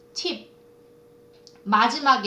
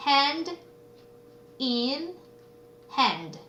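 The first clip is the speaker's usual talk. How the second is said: "Hand in hand" with pauses, first words raised, last falling; 3 semitones higher, much slower than usual. Shown as a demonstration. Teacher presenting a phrase to students, "Hand in hand" word by word. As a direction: space out each word of "Hand in hand". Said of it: "손을 맞잡고".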